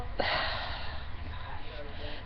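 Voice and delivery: long, breathy exhale; long sigh. Real, not acted. Storyteller bracing for the next bus incident, long sigh. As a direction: let out a big, drawn-out sigh.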